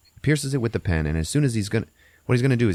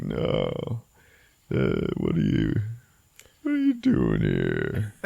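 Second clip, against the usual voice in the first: deep, slow menace; low, drawn-out growled question; Stephen Lang; 9 semitones lower, slower than usual. Retold or imitated. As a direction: imitate a threatening tough old vet. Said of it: "I love his voice".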